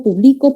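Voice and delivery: "publico" stressed on BLI; pu-BLI-co, the present-tense verb; stress on middle syllable. Shown as a demonstration. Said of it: "cambiándole la ubicación del acento".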